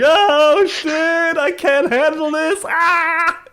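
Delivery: high, loud whiny panic; hammed-up villain whimpering in terror; mock crying panic. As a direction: wail like a coward fleeing battle.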